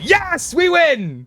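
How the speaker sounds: victory cry. Acted. Lawyer celebrates the win.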